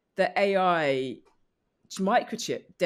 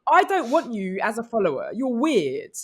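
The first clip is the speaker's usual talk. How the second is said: mock brush-off; 5 semitones higher, 6 dB louder than usual. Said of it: "I'm like".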